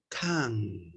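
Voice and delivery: Can. ending on an open mouth, lips apart; mouth left open. Shown as a demonstration. Teacher showing students the open-mouth ending. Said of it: "Mire, mire mi boca".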